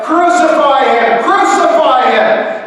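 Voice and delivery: very high, full-force yell; crowd shouting. A narrator's reading. Crowd demands death from Pilate, shouting. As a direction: yell like a baying mob. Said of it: "And they shouted out".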